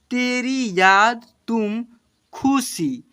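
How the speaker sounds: slow, drawn-out syllables for pupils; slow reading aloud. Shown as a demonstration. Teacher reading slowly to children, drawn-out. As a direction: read each word out slowly. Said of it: "देखिए यहाँ पर क्या लिखा हुआ है".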